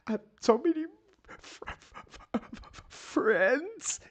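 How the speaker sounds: very high, stammering mock sob; hammed-up imitation of fake tears; mock fake crying. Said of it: "He's fake crying".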